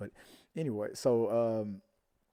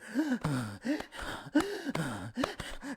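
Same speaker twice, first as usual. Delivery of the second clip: high, breathy gasp for air; a sharp mock gasp; imitated breathless gasp; 12 semitones higher than usual. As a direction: gasp like a girl out of breath after running. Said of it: "She's like".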